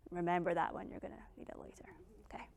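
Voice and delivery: fast, soft conspiratorial whisper; whispered aside. Real, not acted. Speaker tipping off audience, fast whispered aside. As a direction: lean in and whisper it as a secret tip.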